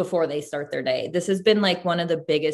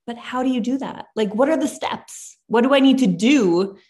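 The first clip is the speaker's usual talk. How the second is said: higher-pitched, insistent retold questions; bewildered questioning; 6 semitones higher, 4 dB louder than usual. Mentee questioning her mentor about how to rest, bewildered. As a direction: press for practical steps, exasperated and baffled.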